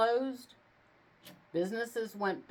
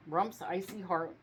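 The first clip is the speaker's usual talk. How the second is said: usual speaking voice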